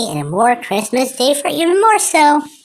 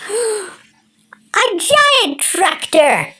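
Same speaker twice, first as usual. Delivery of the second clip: high, slow amazed gasp; gasping surprise; 9 semitones higher, much slower than usual. Acted. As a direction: gasp at something huge. Kid sees a giant tractor.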